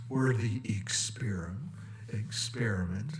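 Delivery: hushed whisper of experiment; conspiratorial whisper. Acted. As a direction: breathe it like a secret.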